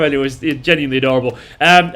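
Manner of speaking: normal talking voice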